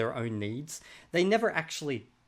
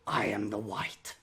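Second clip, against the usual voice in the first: lightly voiced looming deer, lower and slow; a hint of a menacing creature; ominous deer voice; 5 semitones lower, 4 dB quieter, slower than usual. Evil deer hovering above his bed, lightly lowered, slow. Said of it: "looking down upon him going".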